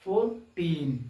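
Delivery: Fourteen split as फोर, pause, टीन; separated syllables. Shown as a demonstration. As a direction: give fourteen two separate beats. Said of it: "separate करके समझाने के लिए". (Fourteen को दो टुकड़ों में अलग करके बोलें)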